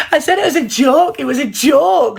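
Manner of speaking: dismissive mimic. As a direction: brush it off like she did. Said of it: "but she said".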